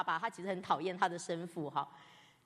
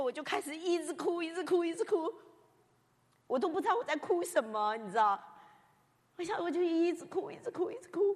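high, tear-choked retelling; moved almost to tears; a touch of tears; 9 semitones higher, 4 dB louder than usual. Real, not acted. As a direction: let the voice catch as you recall crying.

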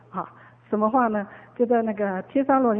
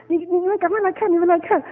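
higher, eager call to come look; 7 semitones higher, 3 dB louder than usual. Retold or imitated. Child urging his aunt to come look, eager and high. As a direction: urge them over, excited.

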